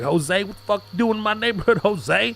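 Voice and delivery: mock gangster threat; gang member threat. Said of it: "And they were like".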